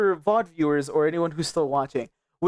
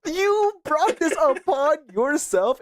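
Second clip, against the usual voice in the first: high, slow, wailing reproach; mock outrage; 8 semitones higher, much slower than usual. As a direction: drag out the playful blame in a high wail. Repeats mock accusation, drawn out.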